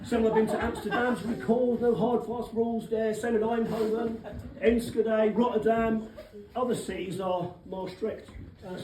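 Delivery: usual speaking voice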